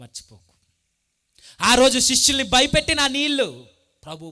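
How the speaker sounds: loud, rising preacher's cry; preaching at full force. Acted. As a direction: thunder it out to the congregation. (ఉద్వేగంతో గట్టిగా బోధించడం)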